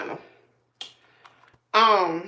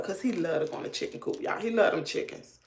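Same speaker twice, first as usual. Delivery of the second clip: normal talking voice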